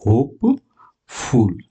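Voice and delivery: drawn-out hopeful; teacher stretching the word for students; slow spelled-out word. Shown as a demonstration. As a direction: say it slowly for the class. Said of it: "तो इसको लिखेंगे".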